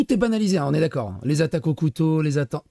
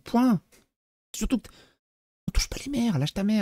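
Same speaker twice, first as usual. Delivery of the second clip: higher-pitched, hammed-up indignation; mock outrage; 6 semitones higher than usual. Streamer parodying outrage over mothers, mock-indignant. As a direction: play at being offended for the mothers.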